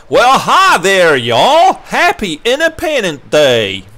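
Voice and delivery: loud, high Southern American drawl, drawn out at the end; best American accent. Shown as a demonstration. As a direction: put on a big, slow Southern "y'all" accent. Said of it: "let me do my best American".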